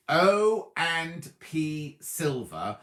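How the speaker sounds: O and P Silver said very slowly, each word drawn out; slow and clear. Shown as a demonstration. Host answering a viewer, says the brand name very slowly. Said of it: "Can you say the name of your company".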